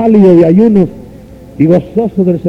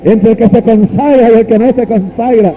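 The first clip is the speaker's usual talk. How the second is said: preaching at full force; 6 semitones higher, slower than usual. Real, not acted. Preacher to congregation quoting Malaquías, high fervent preaching. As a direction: lift the congregation with each cry.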